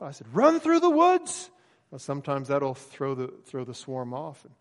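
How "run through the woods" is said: urgent shout. Retold or imitated. Yelling to stung boy in swarm.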